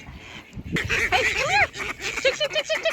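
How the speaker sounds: high repeated tick tick call; calling chickens. Real, not acted.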